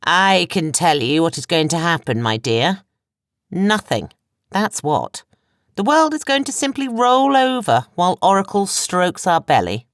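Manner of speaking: high, loud, contemptuous sneer. A narrator's reading.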